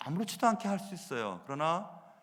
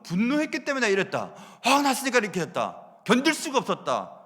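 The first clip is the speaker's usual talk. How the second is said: louder, higher self-justifying complaint; louder mock excuse blaming anger; angry excuses; 5 semitones higher, 6 dB louder than usual. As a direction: mimic someone excusing their outburst. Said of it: "라면서 꼭 그렇게 할 필요는 없지".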